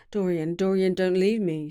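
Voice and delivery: usual speaking voice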